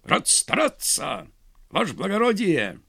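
mock parade-ground answer; soldierly report. A narrator's reading. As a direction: snap it out like a soldier at attention. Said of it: "старательно вытянулся".

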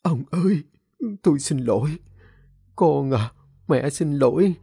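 a touch of choked-up remorse, rising on ông ơi; choked apology. Acted. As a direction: apologize to husband and son, holding back tears. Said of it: "nghẹn ngào nói". (vợ quỳ xin lỗi chồng con, giọng nghẹn ngào)

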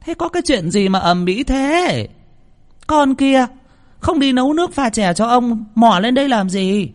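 high piercing scolding lady of the house; shrill scolding. A narrator's reading. Mistress of the house scolding the maid in a piercing voice. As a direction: scold the maid sharply from above. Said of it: "cất giọng lanh lảnh".

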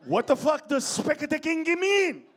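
high, loud, exasperated question; baffled shout. Acted. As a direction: yell in total bafflement. Baffled outburst at clerk's words.

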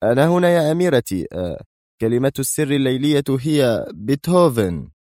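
nervous eagerness pushing through; barely hidden tension. A narrator's reading. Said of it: "يقول في توتر لم يستطع حجبه".

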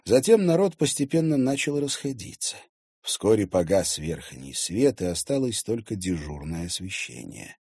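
usual speaking voice

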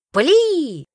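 loud, high bellowed order; roared command. A narrator's reading. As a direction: roar the order to fire. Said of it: "взревел Цая".

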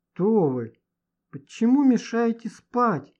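groggy, slow, slurring words; half-asleep drugged mumble; slurred speech. Acted. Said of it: "заплетающимся языком проговорил Женя". (заплетающимся языком, сонно)

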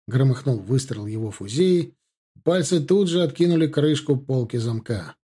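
plain reading voice

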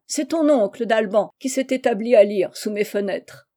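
ordinary delivery, nothing marked

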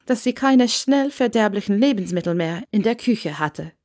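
plain reading voice

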